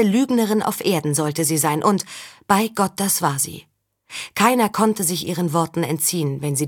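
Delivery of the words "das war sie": ordinary delivery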